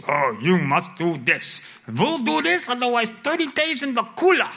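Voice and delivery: hammed-up German-accented commandant; demanding in mock German accent. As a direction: order it in a put-on German accent. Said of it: "Requiring means".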